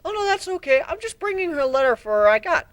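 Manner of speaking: put-on very high, loud young dragon voice; little dragon calling at a door; eager Spike.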